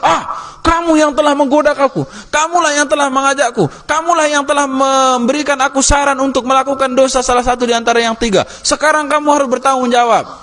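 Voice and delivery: high pitched, pressing reproach; accusing Barsiso. Acted. Condemned man accusing the tempting devil, high reproachful voice. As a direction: throw the blame at the devil, up high.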